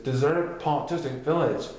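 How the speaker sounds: plain reading voice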